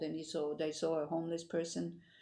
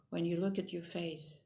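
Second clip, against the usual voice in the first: ordinary talk, nothing marked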